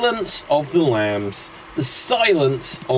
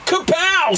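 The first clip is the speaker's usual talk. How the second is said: higher, shouted comic book kapow; comic sound effect; 14 semitones higher than usual. Acted. Fight scene punch, higher cartoon sound effect. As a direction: bang it out like a comic panel.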